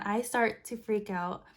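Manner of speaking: ordinary talk, nothing marked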